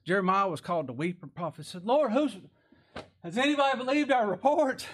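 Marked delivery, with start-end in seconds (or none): 1.9-4.9 high-pitched plea to the Lord; acted, high-pitched cry of appeal; prophet's outcry. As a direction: call it out high like an exasperated prophet. Voices the weeping prophet's plea.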